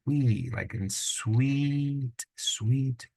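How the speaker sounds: wee said slow and high, like in sweet; drawn-out wee. Shown as a demonstration. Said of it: "it's got a wee sound to it".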